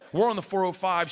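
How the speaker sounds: ordinary talk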